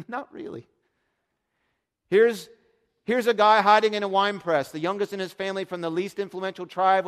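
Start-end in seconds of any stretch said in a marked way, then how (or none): 2.1-4.7 loud, higher, urgent delivery; excited loud preaching. Real, not acted. Preacher describing hiding Gideon with full force. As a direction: drive it home with force.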